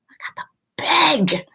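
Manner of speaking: whispered, fake-sobbing plea; mock crying whisper. Mother fake-crying over kids who won't film TikToks. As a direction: pretend to cry while begging.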